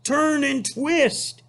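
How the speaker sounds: high, slow honking voice; high drawn-out goose honk. Acted. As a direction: honk the order out slowly. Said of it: "honked the goose".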